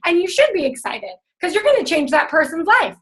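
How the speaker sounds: ordinary talk, nothing marked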